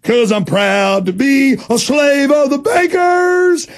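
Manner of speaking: mocking, slow, high hammed-up patriot voice; sarcastic proud patriot. Host mocking a loyal citizen with a slow sarcastic shout. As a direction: sneer it like a brainwashed flag-waver. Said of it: "and you'll go".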